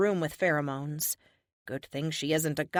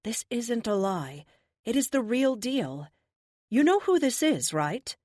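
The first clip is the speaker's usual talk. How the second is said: honey voice; 6 semitones higher, 3 dB louder than usual. Acted. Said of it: "Kate says in her honey voice".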